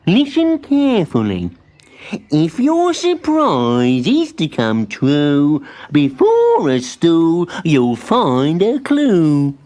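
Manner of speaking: slow, loud recited rhyme; solemn riddle. A narrator's reading. Muddle recites the clue to Timbertwig, slow and grand. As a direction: recite it like an oracle.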